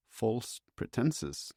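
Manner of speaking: baffled doctor repeating the accusation; confused, defensive. Acted. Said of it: "Meisner sounded confused, defensive".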